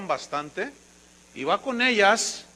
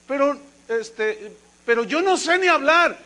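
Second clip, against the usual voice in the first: stammering excuse; 6 semitones higher, 5 dB louder than usual. Acted. Moses making excuses to God.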